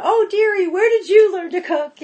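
put-on high, loud, fluting old lady voice; mock grande dame voice; Julia Child. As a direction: imitate Julia Child's warble.

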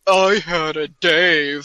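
slow, drawn-out smug boast; mock braggart. Mocking a rich beer buyer, slow smug boast. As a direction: savor each word like a show-off collector. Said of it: "you wanna be like".

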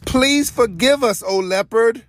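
high-pitched begging for forgiveness; pleading cry. A narrator's reading. Weeping animals beg the leopard for forgiveness, crying out. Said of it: "they cried".

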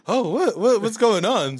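high, retold panicked confusion; freaking out. Customer reliving the shock of finalized charges, freaking out. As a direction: stammer it high, acting out alarm. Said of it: "I'm fucking freaking the fuck out".

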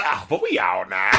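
low, drawled Southern twang; imitating the rapper's twang. Reviewer showing how the rapper delivers the line. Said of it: "it's the way he says".

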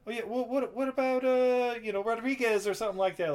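a light caricature of the hero asking around. Retold or imitated. [name] asking about supplier.

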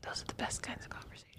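dry sarcastic whisper. Real, not acted. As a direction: breathe it out, deadpan.

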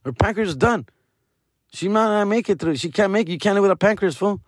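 high, blunt imitation of the doctors; doctors' verdict. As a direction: repeat the bad news pitched well up. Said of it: "they're like".

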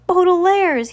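a horrified, breathless call of the name; shocked gasp. Acted. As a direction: cry the name out high and loud in horror. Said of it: "he gasped".

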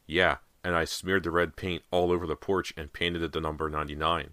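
usual speaking voice

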